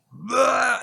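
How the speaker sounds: high, sudden mock vomiting noise; imitated retch. Man reenacting puking over the shovel, imitated retch. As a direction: heave out a cartoonish puking sound.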